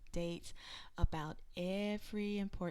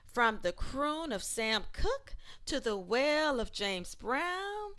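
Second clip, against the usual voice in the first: lively, rhythmic picture-book read-aloud, louder than her chat; reading to children; 4 semitones higher, 7 dB louder than usual. Acted. Reading an ABC history book aloud to children, lively rhythmic read-aloud. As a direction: perform the rhyming verses for a room of kids.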